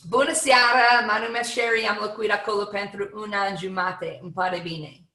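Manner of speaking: bright Romanian greeting, loud start; spoken Romanian. Shown as a demonstration. Speaker demonstrating Romanian to the audience, a Romanian greeting. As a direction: greet them warmly in Romanian. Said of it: "And you say".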